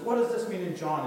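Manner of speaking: acted insistent question; eager questioning. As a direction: imitate a keen convert pressing for answers. Eager convert phoning with questions.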